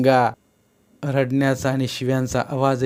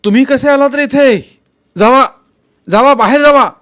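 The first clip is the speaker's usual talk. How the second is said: high, angry yell to get out; loud, high-pitched order to leave; loud shout; 13 semitones higher, 11 dB louder than usual. A narrator's reading. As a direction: shout intruders away at full voice. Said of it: "Kumar जोरात म्हणाला".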